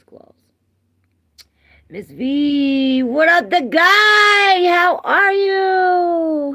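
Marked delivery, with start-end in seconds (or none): 1.9-6.5 very loud, high, stretched-out words; hollered welcome. Real, not acted. Streamer welcomes chat regulars, hollered welcome. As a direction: belt out the hello, holding the vowels.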